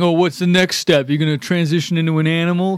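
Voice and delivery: imitated scornful needling; taunting question, as an imitated heckler; mock shaming. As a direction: put on a mocking critic's voice.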